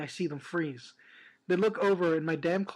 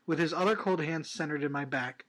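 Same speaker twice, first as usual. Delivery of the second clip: ordinary talk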